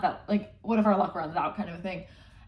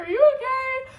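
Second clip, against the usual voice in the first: very high, louder mock concern; mock worried squeak; 8 dB louder than usual. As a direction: squeak it out in fake alarm. Echoed concerned question, very high.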